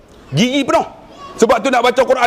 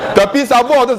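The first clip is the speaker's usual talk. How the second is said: usual speaking voice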